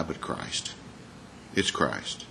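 softly reverent; hushed emphasis. Shown as a demonstration. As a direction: voice drops to almost nothing. Paul quote dropping away.

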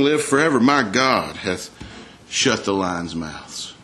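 Daniel speaking, slowed right down; a faithful prophet answering his king slowly; slow solemn declaration. Acted. Daniel voiced solemnly, slowing.

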